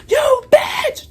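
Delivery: very high, loud shriek; mock hysterical scream at the neighbor; imitated screaming neighbor. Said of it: "And she's like".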